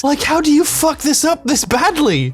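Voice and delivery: high-pitched, incredulous rant; exasperated disbelief. Real, not acted. Critic aghast at how the writers botched it, exasperated. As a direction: throw the question out in exasperation.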